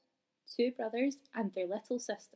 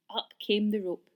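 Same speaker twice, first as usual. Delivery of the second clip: usual speaking voice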